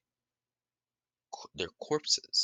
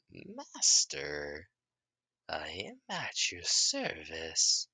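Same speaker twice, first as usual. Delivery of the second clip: slow monster voice; much slower than usual. Retold or imitated. Monster bowing to its master, slow. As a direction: speak like a bowing beast.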